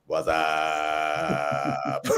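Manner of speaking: long, drawn-out low "whassup"; comically elongated greeting; alien's catchphrase. Retold or imitated. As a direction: imitate the beer-ad alien, stretching the words out. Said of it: "And he says".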